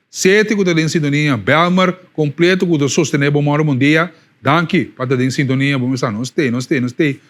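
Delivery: upbeat on-air announcer hype; radio announcer. Acted. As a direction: sell it like a DJ going to a break. Host's radio announcer patter.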